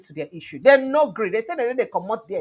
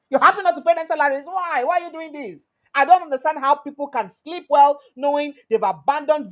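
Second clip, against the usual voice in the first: raised, higher scolding tone; higher, louder angry speech; indignant rebuke; 6 semitones higher, 5 dB louder than usual. Retold or imitated. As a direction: lecture them with rising outrage. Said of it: "Wike was visibly angry".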